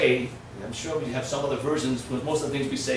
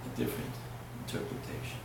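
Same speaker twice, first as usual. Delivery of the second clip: soft, half-voiced old man sharing a secret; quiet retold voice; 4 semitones lower, 11 dB quieter, faster than usual. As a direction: hush it like a confidence. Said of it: "He says".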